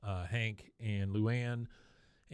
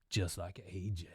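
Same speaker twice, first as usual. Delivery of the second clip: low, quiet retold dig; mean jab; 4 semitones lower, 7 dB quieter than usual. Luann wounding Bobby, a low quiet mean jab. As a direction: drop the voice for a mock cutting remark. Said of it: "did she mean that bad".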